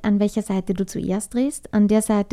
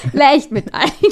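amused laughing echo; 5 semitones higher, 5 dB louder than usual. Real, not acted. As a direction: laugh it back, slower.

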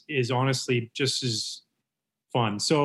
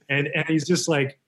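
ordinary delivery

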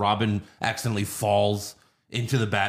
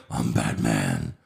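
very low, slow Batman growl; Michael Keaton; slower than usual. Retold or imitated. Comic showing the first Batman, deep slow impression. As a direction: drop it right down, slow and heroic. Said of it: "We went from Michael Keaton".

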